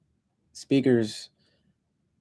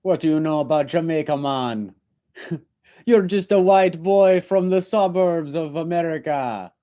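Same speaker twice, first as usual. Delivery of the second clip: fast, higher mock Jamaican taunt; Jamaican accent; 5 semitones higher than usual. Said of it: "talked like that".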